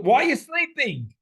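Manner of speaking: high, loud prophetic cry; impassioned plea. Acted. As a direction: rouse God like someone asleep. Voicing the psalmist's plea.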